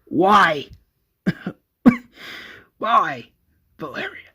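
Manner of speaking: hammed-up dying plea; acted pained whispering. A narrator's reading. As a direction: cough and plead as the wounded victim.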